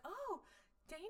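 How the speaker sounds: high, breathy mock surprise; mock surprised greeting. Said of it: "She's like".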